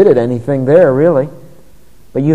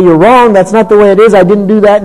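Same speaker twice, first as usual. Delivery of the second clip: defensive retort; 6 semitones higher, 8 dB louder than usual. Retold or imitated.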